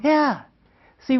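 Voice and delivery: normal talking voice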